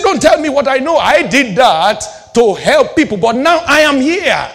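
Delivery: high, loud commanding voice; voice of Jesus. Retold or imitated. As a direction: speak as the Lord, firm and loud.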